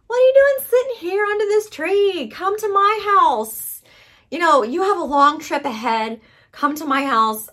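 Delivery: put-on high, loud, slowed coaxing old man; old prophet. Old prophet luring the young prophet home, high and coaxing. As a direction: sweet-talk the tired man into coming home. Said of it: "an old prophet comes along and says".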